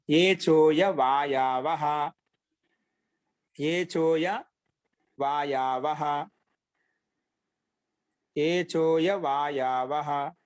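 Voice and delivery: low, drawn-out Sanskrit recitation for note-taking; slow dictation. Acted. Teacher giving students the next sutra to write, slow dictation.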